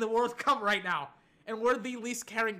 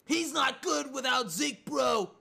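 raised, shouting mock-bro complaint; ranting Cowboys fan; 4 semitones higher, 4 dB louder, slower than usual. Mimicking fans who say Prescott needs Zeke, mock outrage. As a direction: argue like a worked-up fan.

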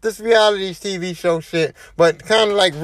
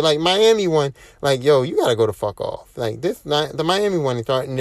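ordinary talk

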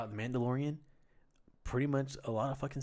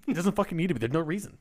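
heated rant; 4 semitones higher, 7 dB louder than usual. Real, not acted. Ranting about Fortnite crossovers.

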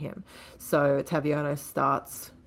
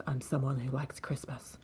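softer, breathy mysterious whisper; 2 semitones lower, 8 dB quieter than usual. Retold or imitated. Taviano answering the wounded Paul, mysterious whisper. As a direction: whisper it like a secret.

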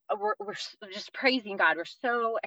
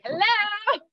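neglected little daughter; 11 semitones higher, slower than usual. Retold or imitated. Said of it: "first daughter is just like".

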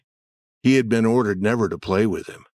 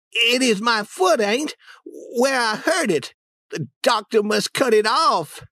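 put-on whiny boy voice; 13 semitones higher than usual. Tom fakes pain.

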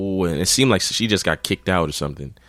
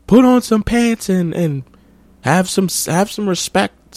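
high put-on scolding voice; mocking the guy; 12 semitones higher than usual. Guy tells the girl to show respect, high mock scold. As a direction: squeak out a preachy scolding. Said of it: "the guy's like".

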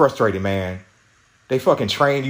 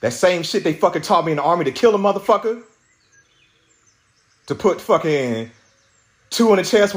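higher-pitched venting, building to the threat; retold rage; 6 semitones higher than usual. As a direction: let the retold rage spill out. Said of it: "Yeah, all that shit came out".

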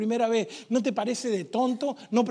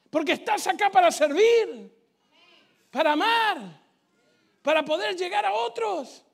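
ordinary delivery

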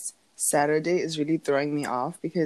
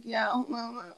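soft, higher just-woke-up mumble; imitated bedroom voice; 5 semitones higher, 8 dB quieter than usual. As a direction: mimic your own sleepy morning voice, softly. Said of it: "it's just my bedroom voice".

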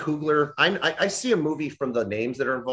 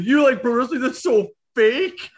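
mocking scoffer; 10 semitones higher, 5 dB louder than usual. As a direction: sneer at the wrestling fan. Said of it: "It's like".

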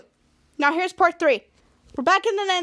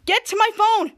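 higher, mock-urgent call; urgent order; 6 semitones higher, 4 dB louder than usual. Chopper hurrying friends to the phone, acted urgent order. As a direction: push it out like an impatient boss.

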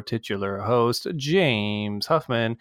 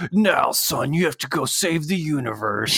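melodramatic anime dad; 4 semitones higher than usual. Retold or imitated. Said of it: "melodramatic writing".

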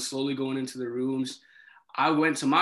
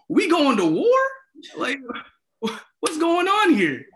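high, loud incredulous questions; alarmed disbelief; 11 semitones higher, 5 dB louder than usual. Retold or imitated. RA retelling armed cops arriving, alarmed disbelief. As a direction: blurt it out in hammed-up alarm.

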